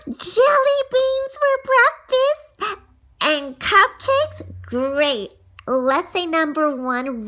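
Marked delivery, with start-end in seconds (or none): 0.1-4.4 excited. Acted. Child thrilled by listener's rule.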